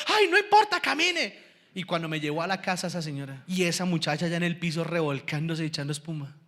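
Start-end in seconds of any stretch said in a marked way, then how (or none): 0.1-1.4 high-pitched mock urgency; hammed-up high voice, hurrying him along; imitated insistent woman. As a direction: brush off the objection as the frantic mother.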